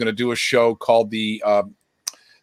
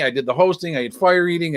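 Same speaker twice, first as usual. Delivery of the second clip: ordinary delivery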